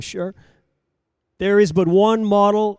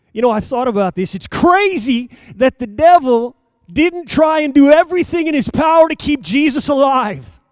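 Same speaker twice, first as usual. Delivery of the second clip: higher, louder, fast rising exclamation; preaching at full force; 7 semitones higher, 9 dB louder than usual. Acted. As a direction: build it up like a fiery preacher. Preacher builds to shouting.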